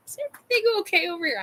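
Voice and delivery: quick, high-pitched question; concerned passerby. Retold or imitated. Passerby checks on the noisy client, quick and concerned. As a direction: imitate someone checking in hurriedly.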